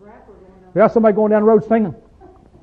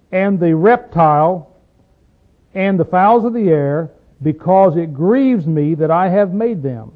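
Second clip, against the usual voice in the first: solemn scripture reading; 3 dB louder than usual. Acted. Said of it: "Doing that verse again".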